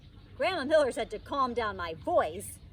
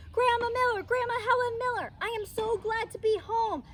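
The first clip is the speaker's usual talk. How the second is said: put-on much higher, louder eager child calling; high excited little-girl holler; 11 semitones higher, 4 dB louder than usual. A narrator's reading. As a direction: call out to grandma, thrilled to be home.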